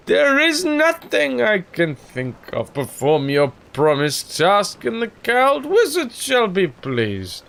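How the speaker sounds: put-on slow, pompous drunken baritone; Orson Welles. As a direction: play it like a tipsy old ham actor. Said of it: "I just want to do this as, uh, as Orson".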